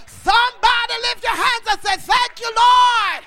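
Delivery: loud, rising preacher's shout over the congregation; preaching at full force. Acted. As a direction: thunder it out like a revival preacher.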